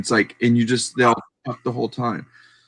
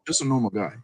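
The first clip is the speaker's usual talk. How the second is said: quieter, offhand repeat; softer echo; 4 semitones lower, 6 dB quieter, faster than usual. Retold or imitated. Echoing the picker's modest words, quietly. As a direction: drop the voice and echo it lightly. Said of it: "That's all he said".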